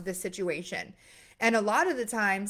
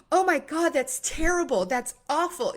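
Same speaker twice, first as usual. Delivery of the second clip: shocked sympathy; 5 semitones higher than usual. Retold or imitated. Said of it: "they're like, gasps".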